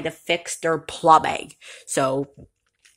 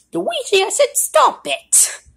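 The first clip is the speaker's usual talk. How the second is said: sharp, much higher cry of stop it; a brother fed up with teasing; high annoyed yell; 14 semitones higher than usual. Acted. Mario yelling at Luigi to stop teasing, high and annoyed.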